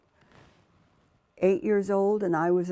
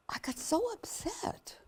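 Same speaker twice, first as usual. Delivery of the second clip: whispered upset; 11 semitones higher, 9 dB quieter than usual. Real, not acted. Distress remembered.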